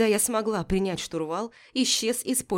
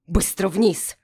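sharp, commanding, louder order; sharp commanding shout; 5 dB louder, slower than usual. Acted. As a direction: bark the order down the deck. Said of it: "резкий, властный".

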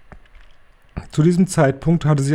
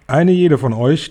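higher, louder booming command; higher, loud booming address; thundering; 5 semitones higher, 5 dB louder than usual. Acted. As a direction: boom it over the hall. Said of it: "donnerte die Hoch- und Großmeisterhexe".

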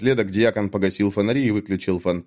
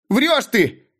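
much higher, squealing yell; shrill shriek; 12 semitones higher than usual. A narrator's reading. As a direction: shriek it almost like a woman. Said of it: "крикнул Мик визгливо, почти по-женски". (Мик визгливо кричит на Натана)

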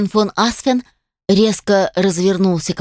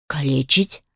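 surprised; 6 semitones lower, 5 dB quieter than usual. A narrator's reading. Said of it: "промелькнуло удивление".

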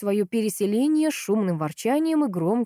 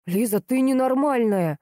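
slow, higher-pitched outraged reproach; indignant; 6 semitones higher, 4 dB louder, slower than usual. A narrator's reading. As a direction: draw out the indignation. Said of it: "возмутился братец". (возмущённо, растягивая слова)

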